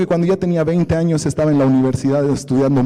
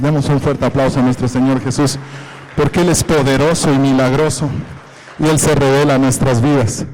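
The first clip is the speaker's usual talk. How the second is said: louder preaching call to the crowd; rally the congregation to clap, full voice; 4 dB louder than usual. Real, not acted. Preacher urges the congregation to applaud Jesus, louder.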